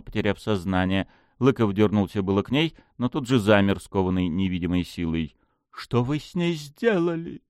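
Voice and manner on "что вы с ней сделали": slow hiss through the teeth. Acted. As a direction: hiss it, seething. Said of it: "прошипел он сквозь зубы".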